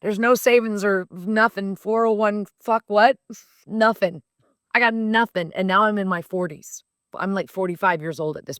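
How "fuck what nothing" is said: normal talking voice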